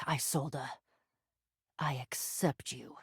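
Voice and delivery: very low and hushed; solemn acceptance. Acted. Hero accepting Isolda as part of self, quietly solemn. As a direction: drop low and soft, accepting completely.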